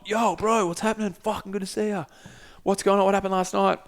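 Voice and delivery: high-pitched, matey retold hello; excited greeting. Greeting Sean as he turns up, excited and high.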